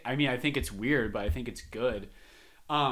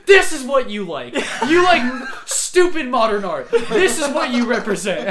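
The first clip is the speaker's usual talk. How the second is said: high, loud mock lecture; hammed-up scornful teacher; teacher at whiteboard mocking modern art; 8 semitones higher, 9 dB louder than usual.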